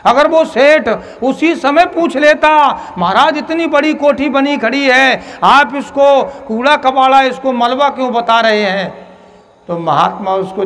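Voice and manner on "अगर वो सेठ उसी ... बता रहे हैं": raised questioning voice. Acted. Asking the holy man, hypothetical.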